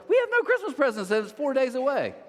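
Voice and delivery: high, loud mock-panicked mom; panicked exclamation. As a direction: voice shoots up in mock dismay. Retelling a panicked mom's exclamation.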